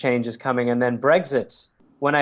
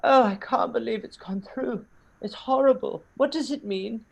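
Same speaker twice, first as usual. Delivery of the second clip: high, dismayed tipsy mum; imitating his hammered mother fretting; mother drunk in LA, mock dismay; 10 semitones higher than usual. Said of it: "and she goes".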